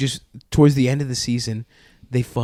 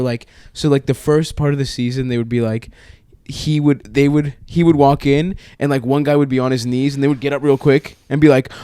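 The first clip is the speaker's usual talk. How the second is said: ordinary talk, nothing marked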